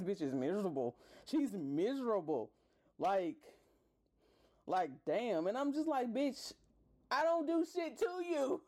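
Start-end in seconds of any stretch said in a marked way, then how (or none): 6.1-8.7 high, laughing through the retort. Retold or imitated. Telling the girl off, laughing high. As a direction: laugh it off at her.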